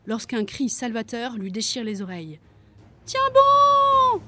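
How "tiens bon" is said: piercing, very high sustained scream; screaming encouragement. A narrator's reading. Siren urging the overwhelmed dragon on, screaming. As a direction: scream it with all the lungs, holding the last word. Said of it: "s'époumonne la sirène".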